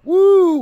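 high loud whoop. Real, not acted. Cheering the boy.

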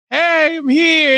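high, slow, drawn-out hail; imitated tentative call-out. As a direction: call at a door as a little girl would. Child calling at ice seller's door.

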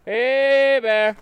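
loud sharp call to warn a bear; scaring off a bear. Real, not acted. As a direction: shout it out to chase a bear away. Calling out to a bear on trail.

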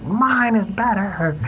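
smug boast. Retold or imitated. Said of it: "I was like".

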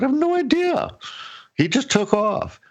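high, baffled reply to his wife; clueless surprise. Retold or imitated. Retelling his reply to his wife, high and baffled. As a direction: toss it off, amazed he's not back. Telling wife he doesn't know.

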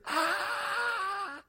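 long, high-pitched scream; mock horror-movie scream, held high; fearful scream. Disciples crying out in fear at the figure, fearful scream. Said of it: "cried out in fear".

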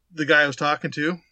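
usual speaking voice

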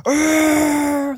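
high, loud Wookiee yowl in a put-on voice; mock Wookiee howl; Chewbacca roar. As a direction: attempt Chewie's roar. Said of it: "I wish I could do that sound".

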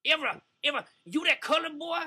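put-on high-pitched caricature Southern accent. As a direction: play a hostile Southern bigot, pitched high.

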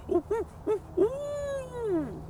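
high hooting coyote howl. Shown as a demonstration. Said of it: "a three-step howl".

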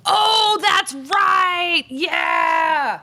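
excited realization. Real, not acted. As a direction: shout it as it finally clicks. Sudden recognition of quiz answer.

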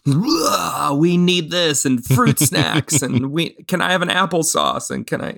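high, whiny pestering child imitation; whiny kid demands. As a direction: mimic a needy kid begging for snacks. Acting out kids' snack demands.

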